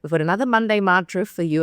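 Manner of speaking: ordinary delivery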